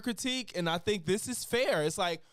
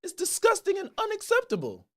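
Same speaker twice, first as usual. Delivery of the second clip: much higher, louder mock outrage in a British accent; outraged Piers Morgan; 11 semitones higher, 6 dB louder than usual. Host railing at his guest, in hammed-up outrage. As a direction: rise into indignant shouting. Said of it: "I'ma show y'all my Piers Morgan impression".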